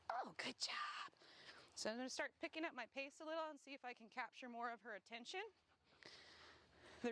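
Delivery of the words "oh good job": whispered praise. Real, not acted. Trainer whispering praise to the dog.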